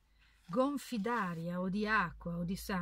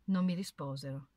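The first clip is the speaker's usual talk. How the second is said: ordinary delivery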